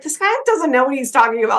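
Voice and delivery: high-pitched mock exasperation; imitating an annoyed past self; retold annoyance. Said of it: "I was so annoyed".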